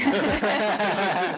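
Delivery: loud high laughter; high burst of laughter. Real, not acted. Laughs at Vietnamese remark.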